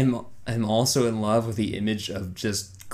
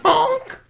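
high, loud honk; imitated clown honk; 5 dB louder than usual. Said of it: "it's just me going".

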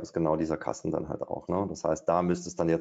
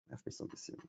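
muttered aside; 19 dB quieter, much faster than usual. Real, not acted. Annoyed aside to himself.

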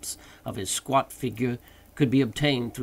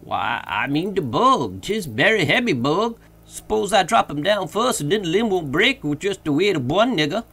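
loud, higher dialect voice; Jupiter, explaining himself; 6 semitones higher, 7 dB louder than usual. Acted. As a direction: protest your reasoning from up the tree.

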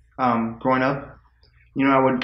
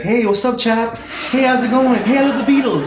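higher, louder hammed-up British-fan greeting; mock chummy Brit; 11 semitones higher, 5 dB louder than usual. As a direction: gush at the fake Brit like a starstruck local. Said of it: "just started saying stuff to me like".

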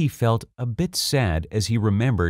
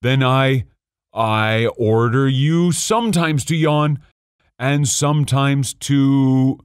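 slow, higher-pitched, vexed royal sputter; 4 semitones higher, slower than usual. A narrator's reading. King amending his order to yawn, vexed and sputtering. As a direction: grope slowly for an order, stretching words in vexation. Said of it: "he sputtered a little and seemed vexed".

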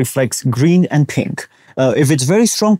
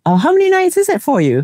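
high, bright-voiced tourist question; excited girl asking, pitched far up; eager question. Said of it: "they asked".

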